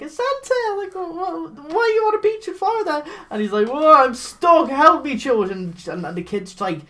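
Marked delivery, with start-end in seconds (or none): 0.0-3.0 put-on much higher, fast child voice; excited kids. Kids discovering Santa on the beach, high squealing. 3.7-5.7 higher, louder hammed-up cry for help; Santa pleading. Stuck Santa begging the children, hammed-up plea.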